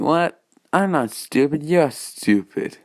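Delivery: put-on high, slurred drunken protest. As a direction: play it like a sloppy drunk. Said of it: "Loki in a drunk voice".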